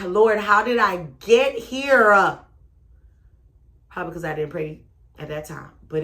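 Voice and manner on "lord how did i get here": despairing plea. Retold or imitated. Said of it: "dreading my life".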